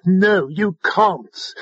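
a mildly yelled, drawn-out no. A narrator's reading. Alva refusing the drone a private talk, yelling. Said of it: "she yelled".

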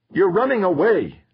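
high-pitched outraged accusation; accusing cry. A narrator's reading. Son accusing his fleeing father, outraged.

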